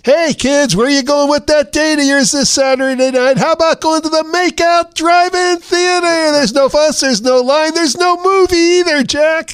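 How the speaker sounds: high, hyped-up DJ patter; radio disc jockey. Retold or imitated. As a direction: slick radio announcer voice. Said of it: "pretending to be a radio disc jockey".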